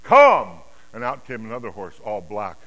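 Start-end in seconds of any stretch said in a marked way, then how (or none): 0.0-0.6 sudden high, loud shout; commanding bellow; thunderous command. Acted. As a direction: thunder out the summons. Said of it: "in a voice like thunder".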